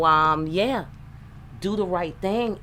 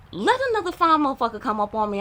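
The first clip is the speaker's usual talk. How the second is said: faster and higher, fired up; hyped-up repeat; 7 semitones higher, faster than usual. Acted. To her listeners, daring other women, fired up and fast. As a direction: throw it out fast like a dare.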